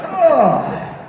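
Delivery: loud, high straining "oh"; acted grunt of lifting; effortful groan. Acting the effort of lifting tail.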